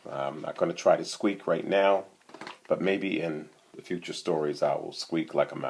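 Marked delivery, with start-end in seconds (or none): none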